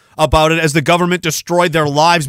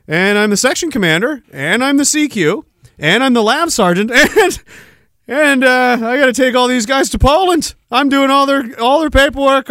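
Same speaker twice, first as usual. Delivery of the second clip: higher, laughing imitation of an overloaded soldier; mock buddy voice; 6 semitones higher than usual. Imitating his buddy listing every job he holds, higher. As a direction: rattle off the jobs like a swamped sergeant. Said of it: "He's like".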